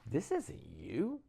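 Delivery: taunting inner voice that jumps high and loud at the end; mock devilish whisper-in-the-head turning shrill; Satan's voice. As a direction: voice the tempter in his head, climbing sharply. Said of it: "it's the very first time I knew what Satan's voice sounded like".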